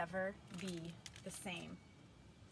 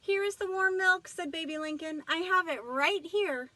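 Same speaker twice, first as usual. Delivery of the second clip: eager excitement; 8 semitones higher, 9 dB louder than usual. A narrator's reading. Eager Baby Lincoln brings milk.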